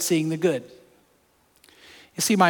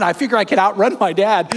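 ordinary talk

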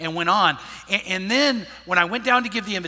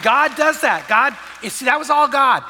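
raised, higher preaching voice; emphatic proclamation; 6 semitones higher, 6 dB louder than usual. Real, not acted. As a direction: proclaim it loud and high to the room. Giving God the credit to congregation.

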